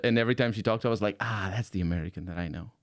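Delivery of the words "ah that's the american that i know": low, soft retold aside; quiet recognition. Visitor recalling hearing a Seattle colleague, quiet recognition. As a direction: drop down into a soft, knowing murmur. Retold relief at familiar accent.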